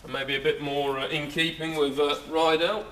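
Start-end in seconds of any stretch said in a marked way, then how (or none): none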